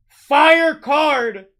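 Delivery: high, loud burst on fire; excited shout. Real, not acted. Breaker reacting to a great pull, loud excited shout. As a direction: blurt it out thrilled.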